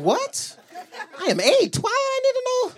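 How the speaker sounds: put-on very high squeaky kid protest; shocked eight-year-old. Boy reacting to dad's blunt answer, high shocked protest. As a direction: shoot way up into a bewildered child's voice.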